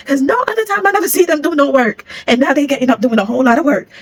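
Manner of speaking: loud and high, still laughing; amused rant. Real, not acted. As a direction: push it out, indignant and amused. Laughing rant at lazy coworkers.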